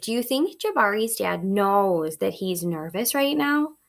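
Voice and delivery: usual speaking voice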